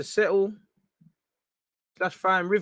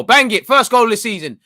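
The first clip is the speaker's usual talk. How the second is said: shouted urging, higher and much louder; excited shout; 5 semitones higher, 10 dB louder than usual. Real, not acted. Fan urging Smith Rowe to shoot, excited shout. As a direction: burst out loud, willing the shot in. Urging a shot during live match.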